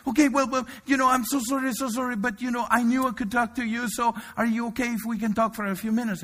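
imitating a flustered young caller; frantic apology. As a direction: stammer the apology in a rush. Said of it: "She's like".

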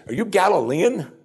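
high-pitched, incredulous jab; insulting. Acted. Pharisees insulting Nicodemus, scornful disbelief. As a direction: sneer at him for believing it. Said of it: "They're insulting him as best they can".

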